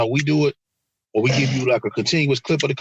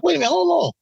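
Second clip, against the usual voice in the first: incredulous; 10 semitones higher than usual. Retold or imitated.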